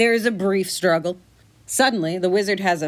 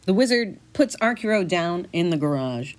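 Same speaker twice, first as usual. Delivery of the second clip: ordinary delivery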